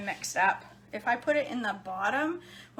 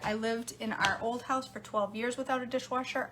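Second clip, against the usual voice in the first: ordinary talk, nothing marked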